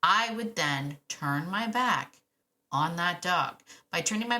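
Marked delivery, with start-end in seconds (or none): none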